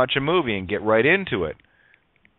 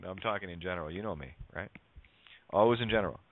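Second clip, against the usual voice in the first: dry, mock-innocent sarcasm; deadpan irony, softer and lower; sarcastic; 5 semitones lower, 9 dB quieter, faster than usual. Real, not acted. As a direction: say it so they know you mean the opposite. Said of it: "I say that with complete sarcasm".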